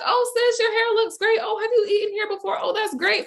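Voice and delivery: very high, gushing imitation of small talk; friendly greeting. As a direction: greet a stranger like an old friend. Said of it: "we have the same interactions".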